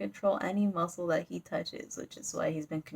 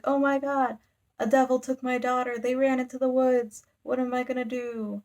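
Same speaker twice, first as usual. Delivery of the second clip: higher, pleading storyteller's panic; mock panic; 5 semitones higher than usual. Father whose daughter was taken by a devil, mock panicked. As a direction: act out a distraught parent, lightly hammed-up.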